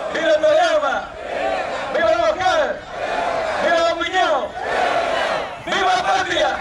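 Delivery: high, ringing rally chants; Luisín's booming balcony speech. Pretend. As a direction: shout each cheer like a politician on a balcony. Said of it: "Yo también ahora voy a representarlo a él".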